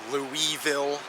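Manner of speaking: "Louisville" as LOO-iss-vill, with the S sounded; mispronounced Louisville. Shown as a demonstration. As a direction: sound the S in "Louisville", like Lewis-ville. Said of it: "not Louisville".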